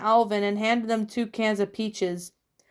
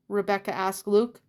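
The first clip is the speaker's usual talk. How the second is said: plain reading voice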